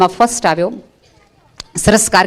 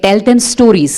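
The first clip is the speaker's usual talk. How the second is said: slow quote; much slower than usual. Retold or imitated. Quoting Einstein's advice.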